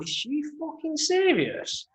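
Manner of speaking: high, slow, drawn-out disbelief. Retold or imitated. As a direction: stretch out the outrage in disbelief. Repeats disbelief, slower.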